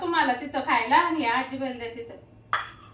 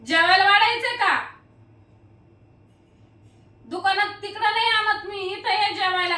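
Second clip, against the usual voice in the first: louder and higher, calling across the house; 6 semitones higher, 4 dB louder than usual. Real, not acted. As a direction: call it out to someone in the next room. Calling out to family inside. (दुसऱ्या खोलीत मोठ्याने हाक)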